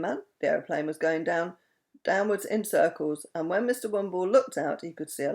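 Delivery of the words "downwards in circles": plain reading voice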